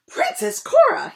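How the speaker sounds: high, loud cry; king shocked at the torn dress; shocked shout. Acted. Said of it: "he shouted".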